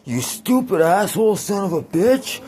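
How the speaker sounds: high, mock furious drunken yell; drunk dad yelling. Dad yelling at hotel clerk who wants ID, drunk rant. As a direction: rage like a drunk dad denied entry. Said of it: "he's like".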